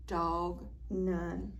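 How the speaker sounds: usual speaking voice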